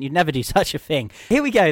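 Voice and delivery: ordinary talk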